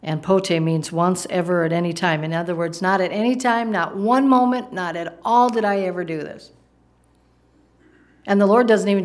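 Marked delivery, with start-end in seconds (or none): 2.8-4.7 higher, mounting insistence on each phrase; emphatic denial. Acted. Preacher spelling out Peter's absolute never, emphatic denial. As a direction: build from "any time" up to "one moment". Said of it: "It's very emphatic. It's very absolute here".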